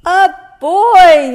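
loud, high startled yell. A narrator's reading. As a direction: yell it out as the shocked bear. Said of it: "yelled the bear".

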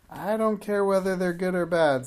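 high, loud witch's snap; a witch brushing off the plea; harsh witch. A narrator's reading. Witch voice held.

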